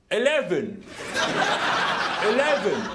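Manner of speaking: amateurish put-on English accent; mock English accent. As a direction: attempt an English accent after clearing the throat. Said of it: "Try an English accent".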